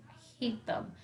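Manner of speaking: choked whisper to mom; tearful whisper. A narrator's reading. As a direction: barely voice it, fighting tears. Boy near tears at doctor's.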